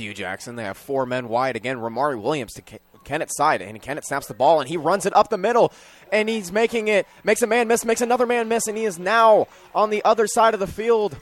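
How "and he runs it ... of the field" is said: high, loud play-by-play building with each miss; fast, climbing announcer's energy; excited call. Real, not acted. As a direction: ride the run all the way downfield. Live call of a quarterback run.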